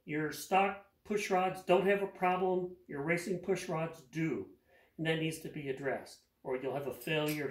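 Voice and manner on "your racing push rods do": normal talking voice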